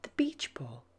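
gentle whispered narration; whispered storytelling. A narrator's reading.

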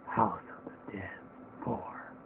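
a breathy whisper of the title. Acted. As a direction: breathe it like a ghost. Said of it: "I nailed it with that voice".

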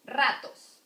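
"Ratos" with a loud, strong first syllable; next-to-last syllable stressed. Shown as a demonstration. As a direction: hit the RA in "Ratos". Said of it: "the strong one is next to last".

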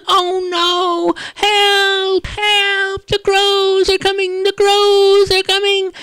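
very high, loud squeaky cries; panicked field mice. Acted. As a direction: shriek for help like tiny mice.

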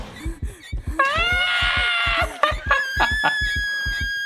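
high-pitched burst of laughter. Real, not acted. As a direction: cackle in shock. Laughs after the chase. (甲高い大笑い)

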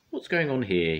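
high, loud little bird; robin voice. Acted. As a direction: call it out like a bird. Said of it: "he asked".

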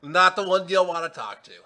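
pirate character. Acted. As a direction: throw it out big and theatrical. Game master answers as pirate character.

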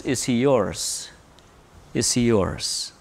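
soft, low personal appeal; quiet searching question. Real, not acted. Pastor asking each listener quietly if Christ is theirs. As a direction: let it hang gently on the listener. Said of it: "Question is".

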